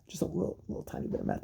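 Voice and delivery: tiny whispered aside; hushed minimizing. Pretend. Comic playing down the singer's drug use, whispered. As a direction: whisper it as if it barely counts. Playfully shrinking the amount.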